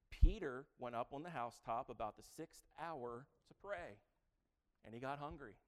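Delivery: reading the verse out to the church; measured Bible reading; scripture reading. Acted. Pastor reads the verse to his congregation, in a measured reading voice.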